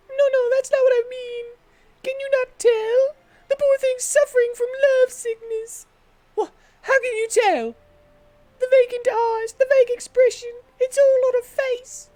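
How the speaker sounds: high-pitched British gossip; British accent. Acted. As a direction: play a fussy British busybody. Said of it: "I just turned her British".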